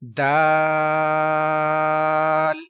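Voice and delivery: दाल held very slow for three seconds; teacher holding दाल for three seconds; long daal. Shown as a demonstration. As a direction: stretch दाल out long and even. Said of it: "तीन second तक लंबा करवाना है".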